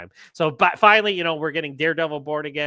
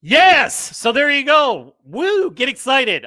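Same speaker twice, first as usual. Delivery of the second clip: high, loud cheering whoop; real excitement; 9 semitones higher, 11 dB louder, slower than usual. Real, not acted. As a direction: cheer like the news just made your day. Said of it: "I mean, I'm excited".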